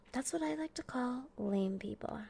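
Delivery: 压低嗓音的耳语; whisper. Acted. Whispered aside.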